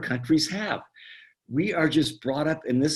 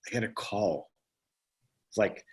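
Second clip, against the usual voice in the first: ordinary talk, nothing marked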